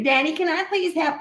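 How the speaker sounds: put-on a high, sugary kid's plea to daddy; sweet little girl. Little girl sweetly begging her daddy for a treat. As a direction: beg like a sweet toddler. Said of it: "that's how I sounded".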